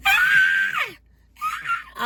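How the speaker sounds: put-on very high shrill "Ah!" like a squealing kid; imitated high squeal; mock squeal. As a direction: squeal like a little girl on the bus. Imitating children squealing on bus.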